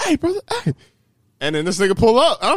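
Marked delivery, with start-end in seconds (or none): 0.0-0.7 high-pitched imitation of a stranger calling; mock persistent caller. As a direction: hail someone from across the way, pitched high. Imitates stranger calling after him.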